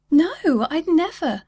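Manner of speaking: high, loud hurt denial; upset protest. Acted. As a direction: cry out that she wouldn't. Kizzy protests the accusation.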